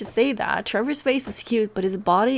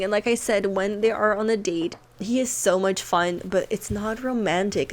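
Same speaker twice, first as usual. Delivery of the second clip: ordinary talk, nothing marked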